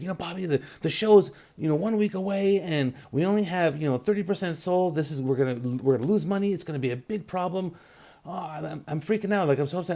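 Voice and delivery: high-pitched, retold panicked producer; freaking out. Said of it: "she was just freaking out".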